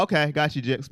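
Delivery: usual speaking voice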